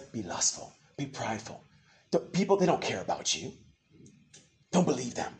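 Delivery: low, hushed tempting voice; sly tempter. Acted. Preacher voicing the spirit that urges sin, hushed temptation. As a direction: drop down soft, like a voice inside the head.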